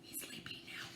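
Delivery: barely audible whisper; hushed sleeping-friend whisper. Acted. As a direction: whisper so he won't wake. Friend fell asleep.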